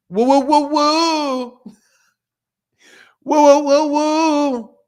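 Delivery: high repeated woo-woo hoots; high-pitched playful whooping; playful hooting. Pretend. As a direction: hoot high, stretching the last woo. Playful hooting after confessing his bluff.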